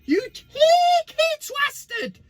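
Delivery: comic high-pitched insult; high-pitched twasted. Acted. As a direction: squeal "You cheeky twasted". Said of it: "yes, I did say twasted".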